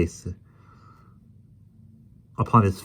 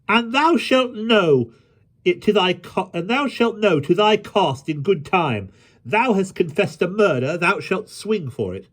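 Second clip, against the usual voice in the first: high-pitched royal threat; indignant boy king; 12 semitones higher, 3 dB louder than usual. A narrator's reading. King warns Hobbs he will pay, high indignant threat. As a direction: threaten him high and emphatically.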